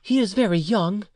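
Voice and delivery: high woman's voice; pleading mother. A narrator's reading. Mother excuses her young son to the uncle, pleading.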